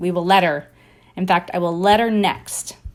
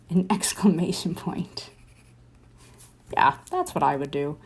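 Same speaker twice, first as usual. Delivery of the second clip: whispered aside; 3 dB quieter than usual. Real, not acted. Laughing aside while drawing.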